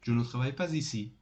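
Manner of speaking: "pas ici" run together quickly as "pa-zici"; with liaison. Shown as a demonstration. Teacher repeating the negative sentence after naming it, with liaison. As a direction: link "pas" to "ici" with a z, at a fast pace.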